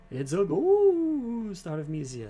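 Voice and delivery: high, drawn-out cry of alarm. Acted. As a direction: yell it out like you just saw a ghost. Dog cries out about a ghost.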